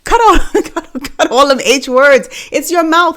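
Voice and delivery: high, loud, laughing plea to drop the words; laughing exasperation. Acted. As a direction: beg through laughter, fed up. Mocking coworkers who avoid words.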